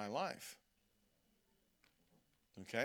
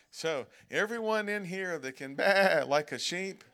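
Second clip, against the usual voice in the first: laughing; 2 semitones higher, 8 dB louder than usual. Real, not acted. Joking about baaing like sheep.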